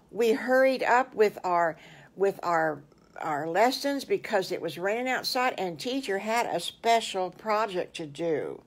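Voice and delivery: plain reading voice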